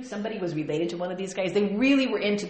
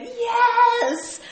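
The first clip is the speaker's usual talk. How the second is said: high, loud triumphant yes; excited cheer; 14 semitones higher, 10 dB louder than usual. Acted. Coach firing up the team, loud cheer.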